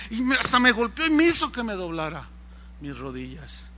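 ordinary talk

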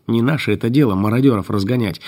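ordinary delivery, nothing marked

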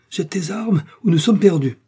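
loud, high desperate order; urgent cry. A narrator's reading. Doctor orders hunter to dump weapons, crying out. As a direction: shout the order as a last chance. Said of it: "s'écria le docteur".

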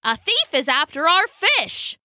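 high penguin outcry; penguin alarm. A narrator's reading. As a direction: raise the alarm in the colony. Penguin crowd raises the alarm.

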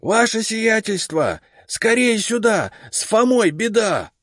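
put-on slow, high panicked old man calling; frightened cry. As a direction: shout for help in terror. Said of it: "перепуганный крик Игната".

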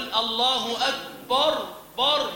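أكبر with a dark, thick "bor"; heavy ba. Shown as a demonstration. Teacher demonstrates an error, over-heavy ba. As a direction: slowly thicken the b and its vowel in أكبر. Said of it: "تفخيم الباء".

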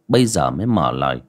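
usual speaking voice

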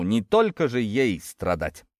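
usual speaking voice

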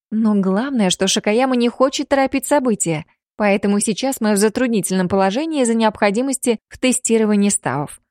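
usual speaking voice

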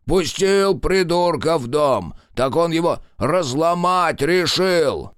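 put-on high, loud old man yelling upstairs; grumpy old grandfather. A narrator's reading. Grandfather yelling at grandson from below, loud. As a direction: bellow up the stairs at the fool grandson.

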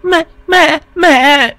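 put-on very high frightened child's cries; panicked child. A narrator's reading. Terrified son calls father about mother, high panicked cries. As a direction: cry out like a terrified little boy. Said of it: "Thằng bé hoảng hồn hét lên một tiếng".

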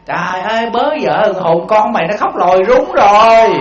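slow, loud neighbor's call across the yard. Retold or imitated. Old neighbor calls her daughter-in-law, loud drawn-out call. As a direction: holler it slowly to the daughter-in-law next door. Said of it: "bà Tư bà đứng đây".

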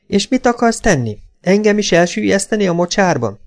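high, challenging mother voice; high-pitched sarcastic challenge; accusing mother. A narrator's reading. Mother challenges son, voiced in story.

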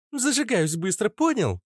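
high, touchy retort; displeased. A narrator's reading. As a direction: snap back in a high girl's voice. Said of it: "недовольно сообщила девушка". (недовольно, высоким голосом)

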